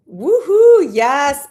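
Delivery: loud, high whoop of joy; whooping yes; excited cheer. Real, not acted. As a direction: celebrate like you just won. Cheering as she goes live.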